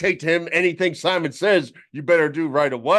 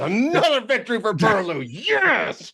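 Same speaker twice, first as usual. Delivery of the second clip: high, crowing victory cheer; gloating, high-pitched cheer; mock triumph; 7 semitones higher than usual. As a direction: celebrate a silly win like a champion. Mock victory celebration after game.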